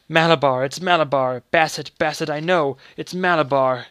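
loud delirious calling; feverish crying out. Acted. Sick boy in fever calls out, loud and delirious. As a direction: rave it out in fever.

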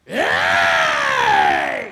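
deep drawn out hero roar; slow menacing bellow; low hero roar. Shown as a demonstration. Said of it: "இப்ப பாருங்க".

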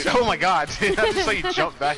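excited. Real, not acted. Hyped reaction to a play.

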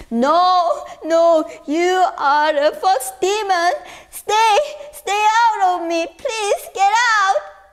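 high loud crying plea; terrified crying. Acted. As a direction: push the demon away in tears. Son rejecting demon.

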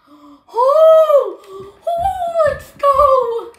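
very high and loud cheer; triumphant yell. Real, not acted. Kid celebrating a top card. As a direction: cheer it like a win.

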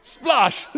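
a big, high splashing "Splosh!"; loud splosh. Shown as a demonstration. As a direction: bellow the splash noise like water landing. Imitating water poured on hands.

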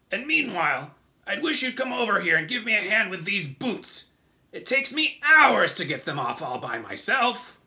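loud, high centipede voice; centipede character. A narrator's reading. Centipede asks James for help with boots, loud high complaint. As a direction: call out loudly, complaining about a chore. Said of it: "the centipede said".

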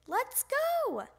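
high, drawn-out summons; father hollering from another room; calling out. A narrator's reading. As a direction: call it out high to hurry him along. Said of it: "called his father".

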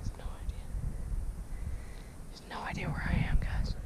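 sneaky whisper. Real, not acted. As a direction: whisper so the target can't hear. Hiding, whispering to camera.

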